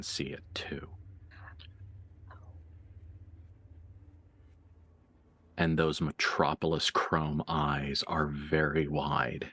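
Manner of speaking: low, hushed narration with long pauses; game master reveal. Acted. GM reveals Elizabeth can see it too, hushed narration.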